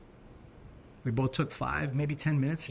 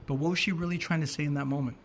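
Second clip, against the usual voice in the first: ordinary talk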